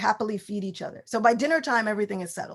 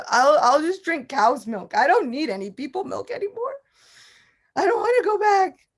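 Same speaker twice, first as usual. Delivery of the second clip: put-on stubborn child; 6 semitones higher, 6 dB louder than usual. Stubborn child refuses to return.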